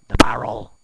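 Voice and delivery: loud punched repeat; loud emphasis. Acted. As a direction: shout the barrel for emphasis.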